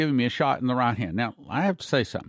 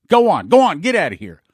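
dismissive shout; 3 semitones higher, 9 dB louder than usual. Retold or imitated. Guard waving him off.

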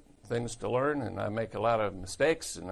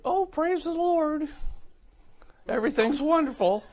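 hammed-up pious cheer, louder at the end; gushing church sister; 3 dB louder than usual. Mimics a falsely cheerful church sister.

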